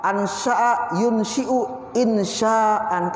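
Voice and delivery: Ansya'a yunshi'u insya'an recited very slowly in Arabic; slow Arabic recitation. Shown as a demonstration. Teacher reciting the Arabic verb forms slowly for students. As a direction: chant the word forms slowly, syllable by syllable. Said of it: "dirubah lagi ke ansya'a".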